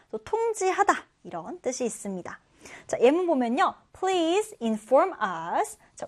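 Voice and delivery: usual speaking voice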